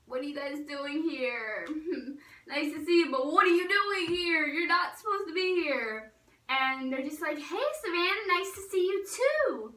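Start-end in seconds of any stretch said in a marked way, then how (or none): 0.1-6.1 higher, louder mock disbelief; acted-out protest at unexpected guests; surprised greeting. Girl to cousins who turned up uninvited, surprised protest. 7.4-9.7 mock cheery greeting. Cousins to girl who questioned their visit, high mock-sweet greeting.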